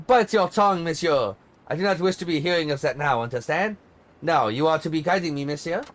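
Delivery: bossy diva in a light mock French accent, sharp start. Diva scolds her tour guide, light mock French accent. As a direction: snap at him in put-on French accent, then command. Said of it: "What do you think of my French accent?".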